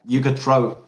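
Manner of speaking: ordinary delivery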